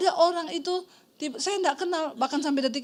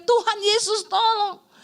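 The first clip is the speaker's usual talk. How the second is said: high, drawn-out mock yell for help; retold shout; 6 semitones higher, 3 dB louder, slower than usual. Wife imitating husband seeing the speeding car, retold shout. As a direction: cry it out slowly, pitched up. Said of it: "Makanya dia teriak".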